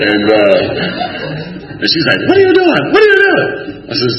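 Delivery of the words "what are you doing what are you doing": very high, shrieky imitation of wife; high falsetto outcry; alarmed wife. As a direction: squeal it in mock panic. Wife's alarmed reply on phone.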